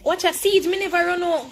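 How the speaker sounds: loud, high hype at a teammate; excited shout. Real, not acted. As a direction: call it out hyped. Hyping teammate mid-game.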